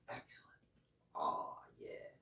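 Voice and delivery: whispering. Real, not acted. Teacher praises quietly during pose.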